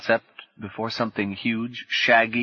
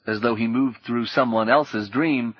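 usual speaking voice

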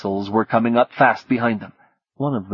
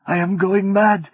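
rising panic; 10 semitones higher than usual. Acted. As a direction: let the fear break out. Martine breaking down.

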